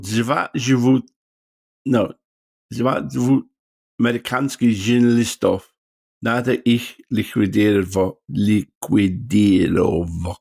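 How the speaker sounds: phonetically learned foreign film line, halting; mock Russian line. Shown as a demonstration. Recalling his line from Whoops Apocalypse, halting foreign accent. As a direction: stumble through the half-remembered foreign line. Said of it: "Or in the film of Whoops Apocalypse".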